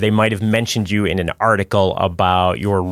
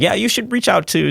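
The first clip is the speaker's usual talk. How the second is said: jumps way higher, lightly acted enthusiasm; eager retold suggestion; 12 semitones higher than usual. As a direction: replay your own keen idea, pitch leaping up. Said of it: "and I was like".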